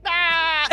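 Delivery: mock running yell. Fleeing the police in game, mock yelping. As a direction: let out a silly fleeing ah. Fake cry while running away.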